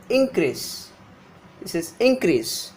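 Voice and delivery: increase with the stress on IN; INcrease, strong first syllable, short second; noun stress. Shown as a demonstration. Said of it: "difference between the pronunciation of the noun".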